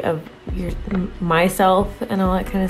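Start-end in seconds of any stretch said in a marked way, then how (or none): none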